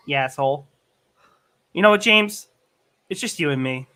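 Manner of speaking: normal talking voice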